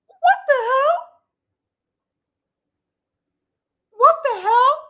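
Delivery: high, loud, incredulous what the hell; outraged disbelief. Real, not acted. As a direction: cry out in disbelief.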